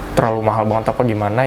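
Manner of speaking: ordinary talk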